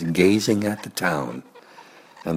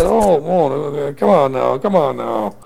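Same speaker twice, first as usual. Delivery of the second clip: high strained pleading; struggling pig; 7 semitones higher, 4 dB louder, slower than usual. Acted. Pig stuck in branches pleads, straining. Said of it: "he said / struggle".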